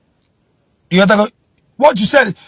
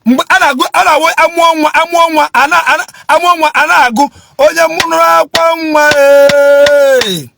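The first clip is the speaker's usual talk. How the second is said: fiery preaching; 6 semitones higher than usual. Acted. Preaching to viewers that parents failed their children, shouting. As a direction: preach it hard to the congregation.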